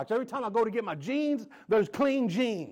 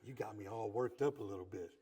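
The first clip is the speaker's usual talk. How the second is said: soft whisper right into his ear; hushed whispered compliment to husband; 12 semitones lower, 12 dB quieter than usual. Retold or imitated. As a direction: lean in and whisper the praise. Lean over into his ear.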